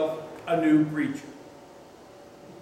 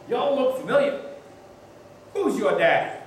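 folksy preacher; 7 semitones higher than usual. Retold or imitated. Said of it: "the preacher looked at him and said".

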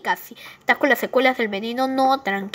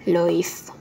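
crying; 5 semitones lower than usual. Acted. Grieving over what Gullino did.

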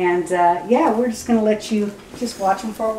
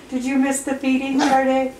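higher, sing-song teasing question; 5 semitones higher than usual. Real, not acted. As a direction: tease the puppy gently. Teasing a puppy in baby talk.

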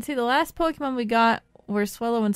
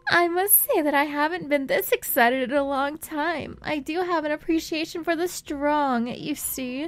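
higher-pitched, creepy villain speech; 5 semitones higher than usual. Acted. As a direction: voice a creepy, self-assured game villain. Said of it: "That's why I'm giving him a creepy voice".

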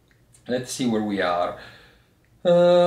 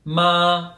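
"ma" held high and level, louder; 7 semitones higher, 5 dB louder than usual. Shown as a demonstration. Teacher showing learners the first Chinese tone, high level "ma". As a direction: say "ma" on one sustained high pitch. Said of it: "so a very high pitch".

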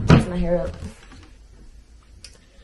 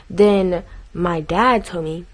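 normal talking voice

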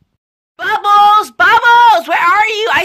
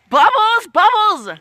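loud high call for a lost friend; 4 dB louder than usual. Acted. Blossom searching for missing Bubbles, calling loudly. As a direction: shout the name across the yard, high.

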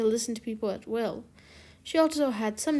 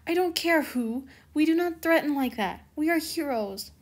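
higher, louder, firm scolding; indignant retort; 5 semitones higher, 5 dB louder than usual. A narrator's reading. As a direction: argue back with raised voice. Acted quarrel with her Kwami.

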